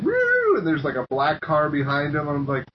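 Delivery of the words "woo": high-pitched imitated siren wail; siren whoop. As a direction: imitate a police siren with your voice. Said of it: "They're like".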